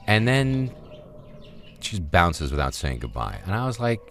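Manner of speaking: usual speaking voice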